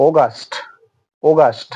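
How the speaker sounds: অগাস্ট said "oh-gast"; British pronunciation. Shown as a demonstration. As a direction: correct British অগাস্ট, clearly modelled. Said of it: "ওদের মতো উচ্চারণ করার চেষ্টা". (সঠিক British উচ্চারণে অগাস্ট)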